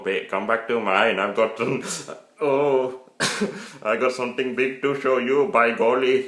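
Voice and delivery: loud, high put-on Indian accent with giggles; mock Indian accent. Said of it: "do an Indian accent".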